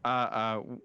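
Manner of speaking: ordinary delivery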